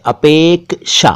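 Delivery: अपेक्षा slowly split as "apek-sha"; syllable-by-syllable demo. Shown as a demonstration. Showing learners the right sound, अपेक्षा slowly broken into "apek-sha". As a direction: draw out अपेक्षा, separating the k from the sha. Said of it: "सही उच्चारण अपेक्षा है".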